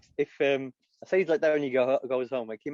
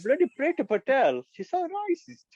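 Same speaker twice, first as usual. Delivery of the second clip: put-on Indian accent; 11 semitones higher, 4 dB louder than usual. Comic impressionist moaning about Boris, Indian accent. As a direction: wail it in a hammed-up Indian accent. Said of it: "He did the voice".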